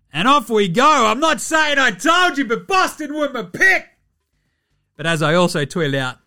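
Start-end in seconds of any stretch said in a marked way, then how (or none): 0.1-3.9 loud, very high gloating yell; crowing shout. Real, not acted. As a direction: shout it high, crowing over your pick. Crowing about his correct pick.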